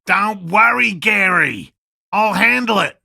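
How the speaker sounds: loud, high call to Gary. Acted. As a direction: shout it across the camp to Gary. Calling out to Gary.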